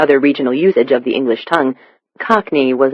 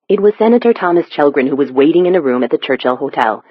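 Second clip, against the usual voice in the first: ordinary delivery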